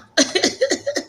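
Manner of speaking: tipsy high-pitched giggle. Real, not acted.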